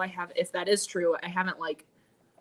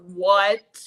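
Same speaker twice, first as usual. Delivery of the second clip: shocked what; 11 dB louder than usual. Retold or imitated. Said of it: "I was like".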